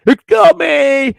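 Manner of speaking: very high, drawn-out mock yell; hammed-up burnt-out character hollering; yelling for mommy. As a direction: wail like an overgrown child calling for mother. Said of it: "yelling at the top of it".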